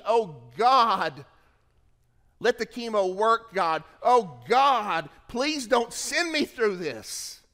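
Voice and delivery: loud, high desperate plea to God; pleading prayer. Pretend. Preacher voicing a sick believer begging God.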